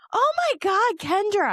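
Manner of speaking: high, loud shocked gasp of a name; high startled squeal; friend's shocked cry. Retold or imitated. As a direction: squeal it like you just saw the mess. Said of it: "she was like".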